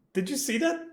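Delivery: ordinary talk, nothing marked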